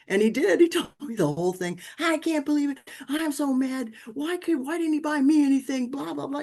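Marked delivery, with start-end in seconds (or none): 2.0-6.4 put-on upset child. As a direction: pitch it way up like a hurt ten-year-old complaining. Son venting, voiced again.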